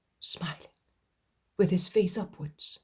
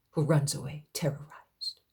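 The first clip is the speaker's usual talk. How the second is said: ordinary delivery, nothing marked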